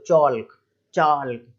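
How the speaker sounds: "चाल्क" with the L sounded; mispronounced chalk. Retold or imitated. Teacher mimicking how people read, "चाल्क" with L sounded. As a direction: say "चौल्क, चाल्क", each L pronounced. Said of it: "लोग पढ़ते हुए".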